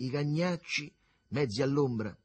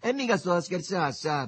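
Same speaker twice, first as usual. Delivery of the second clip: raised, louder, put-out insistence; disgusted; 7 semitones higher, 6 dB louder than usual. A narrator's reading. Begalone answers his pal who thinks him mad, disgusted. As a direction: insist you mean it, disgusted. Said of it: "fece disgustato il Begalone".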